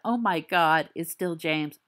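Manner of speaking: usual speaking voice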